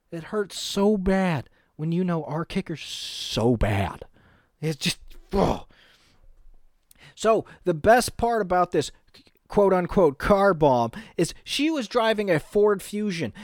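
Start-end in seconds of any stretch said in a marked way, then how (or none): none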